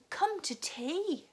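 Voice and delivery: high, slow sing-song invitation; girl inviting her bear home; Katy inviting. A narrator's reading. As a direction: call out sweetly and slowly. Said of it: "said Katy".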